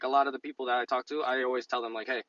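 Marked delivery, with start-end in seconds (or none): none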